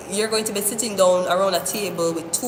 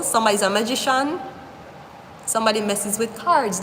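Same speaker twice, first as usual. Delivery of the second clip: ordinary delivery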